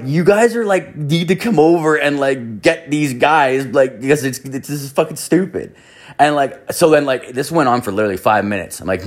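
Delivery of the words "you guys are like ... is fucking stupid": raised, higher call to far-off volunteers. Retold or imitated. Telling the volunteers to come fetch their kids, raised voice. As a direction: shout over to the supervisors.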